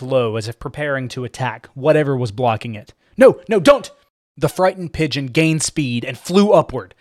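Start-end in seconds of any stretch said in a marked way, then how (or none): 3.2-3.9 high, loud cry of warning; alarmed shout. Acted. Giselle warns pigeon off the ceiling, alarmed shout. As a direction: shout to stop him, in a panic.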